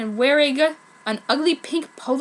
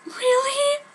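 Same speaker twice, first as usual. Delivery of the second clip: tearful. Acted. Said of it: "crying in tears".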